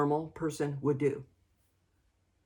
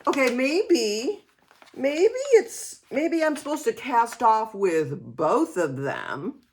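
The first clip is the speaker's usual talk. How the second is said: wondering aloud; 6 semitones higher than usual. Retold or imitated. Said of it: "So then I thought".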